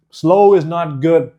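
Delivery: normal talking voice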